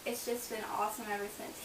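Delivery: ordinary talk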